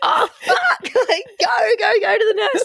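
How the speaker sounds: very high laughing panic; panicked teacher. Retold or imitated. The teacher sees the arm, very high and laughing. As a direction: shriek like the frazzled teacher.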